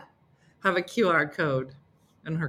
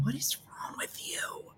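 slow breathless whisper; hushed, unvoiced, slow; 5 semitones higher, 13 dB quieter than usual. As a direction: whisper it in disbelief. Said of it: "me going".

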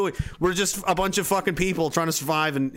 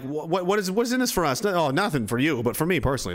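ordinary talk

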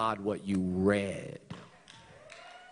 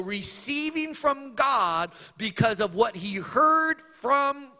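high, loud, driving preacher's cadence; full-force preaching; 11 semitones higher, 8 dB louder, slower than usual. Acted. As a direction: preach it out to the congregation.

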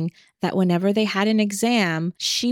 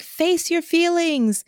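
mock taunt; 9 semitones higher, slower than usual. Teasing her as she walks away.

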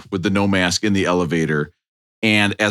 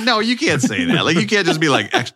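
incredulous protest; 5 semitones higher than usual. Retold or imitated. Retold protest to the therapist.

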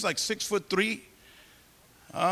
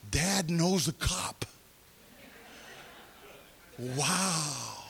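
put-on hushed, drawn-out boyish wonder; fascinated awe; 3 semitones lower, 8 dB quieter, much slower than usual. Son amazed his dad knows a cop, fascinated. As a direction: imitate an awestruck kid, slow and soft. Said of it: "I could tell he was fascinated".

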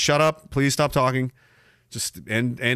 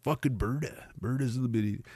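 soft, mumbled Alberta accent; 3 semitones lower, 10 dB quieter than usual. Shown as a demonstration. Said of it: "that's a hard one to do".

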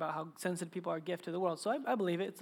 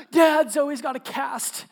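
higher, louder and slow, like eager little daughters; excited kids; 6 semitones higher, 10 dB louder, slower than usual. Retold or imitated. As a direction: blurt the news like an excited child. Younger daughters report the cast.

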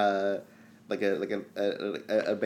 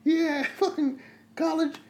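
rowdy sports fan. Acted.

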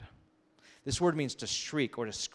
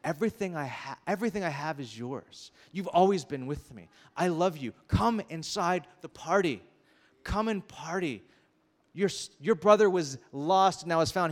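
loving father; 5 semitones higher, 5 dB louder than usual. Retold or imitated. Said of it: "He's like".

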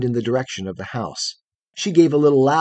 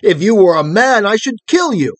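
loud, high-pitched furious outburst; wrathful threat; 9 semitones higher, 9 dB louder than usual. A narrator's reading. Boy threatens the girl who insulted him, wrathfully. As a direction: hurl the threat in anger. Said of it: "started to his feet in wrath".

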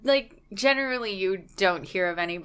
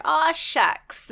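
mock folksy Canadian; 8 semitones higher, 4 dB louder than usual. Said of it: "expecting us to be like".

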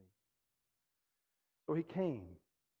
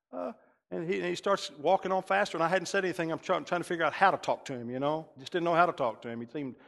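usual speaking voice

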